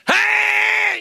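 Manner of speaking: very high, loud desperate shout; death-chilling scream. Shown as a demonstration. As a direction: scream it with everything you have. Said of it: "death-chilling scream".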